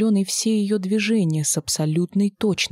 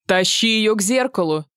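higher, louder sharp command; 4 semitones higher, 3 dB louder than usual. A narrator's reading. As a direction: order him like a boss. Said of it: "скомандовала псевдо-Лиза".

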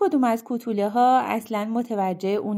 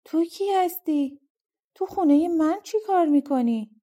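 breathless shock; 6 semitones higher than usual. Acted. Rip facing a stranger in his own house, breathless and stunned. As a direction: gasp the questions out in disbelief. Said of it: "نفسش بند اومده بود".

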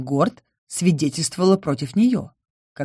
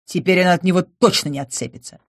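plain reading voice

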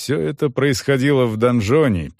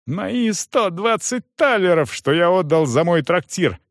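high-pitched indignant insistence; angry yelling; 8 semitones higher than usual. A narrator's reading. Young man demands his payment, furious. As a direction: insist in outrage. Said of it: "орал он".

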